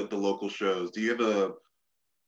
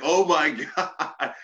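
very slow, high, louder gasped words; breathless; 7 semitones higher, 5 dB louder, much slower than usual. Retold or imitated. On her first day taking bumps, a breathless slow exclamation. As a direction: drag the words out with no air left. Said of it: "breathing hard".

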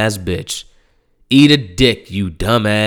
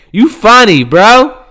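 loud taunt; 10 semitones higher, 8 dB louder than usual. Real, not acted. Taunting Donald louder.

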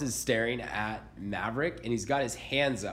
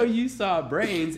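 higher, louder, slow, stretched-out disbelief; mock disbelief; 9 semitones higher, 5 dB louder, much slower than usual. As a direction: play up being amazed for the kid. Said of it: "And so I go".

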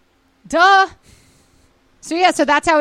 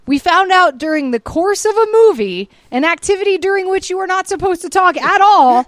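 mock earnest announcement; 7 semitones higher, 5 dB louder than usual. Mocking the couple's announcement.

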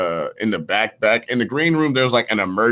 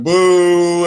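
mock boo, higher and louder; crowd booing; 8 semitones higher, 8 dB louder than usual. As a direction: boo like a let-down audience. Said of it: "everybody was like".

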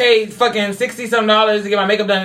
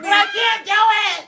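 high, slow, wailed protest; exasperated protest; 11 semitones higher, slower than usual. Real, not acted. Telling friends she can't do her makeup, high exasperated wail. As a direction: drag it out way up high.

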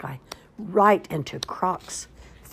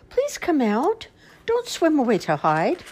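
much higher pleading bunny voice; 9 semitones higher, 4 dB louder than usual. Acted. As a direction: coax the fish out as a little bunny. Bunny voiced in children's story.

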